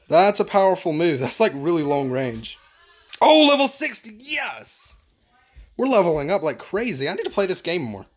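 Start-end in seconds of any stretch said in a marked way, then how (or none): 3.2-5.0 high, excited exclamation; thrilled. Real, not acted. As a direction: celebrate reaching a new level. Levels up in game.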